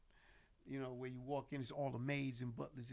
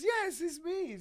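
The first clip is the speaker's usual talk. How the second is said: imitating Mike; 8 dB louder, slower than usual. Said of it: "he says".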